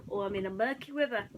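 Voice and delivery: ordinary delivery, nothing marked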